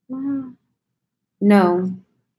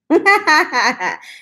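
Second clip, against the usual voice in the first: laughs out loud, high and bright; a real laugh breaking out, pitched up; high delighted laugh at her own joke; high burst of laughter; 10 semitones higher, 3 dB louder than usual. Real, not acted.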